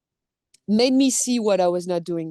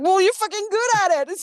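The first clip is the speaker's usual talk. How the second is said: much higher, louder amazed exclamation; astonished praise; 11 semitones higher, 5 dB louder than usual. Retold or imitated. Directors praising her acting, astonished imitation.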